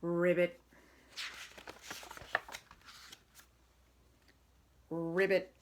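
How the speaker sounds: loud, happy ribbits; piggy's frog croak. Acted. Piggy playing frog for the listening kids, loud croaks. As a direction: croak it proudly like a pretend frog.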